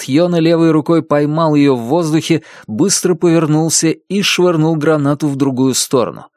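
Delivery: usual speaking voice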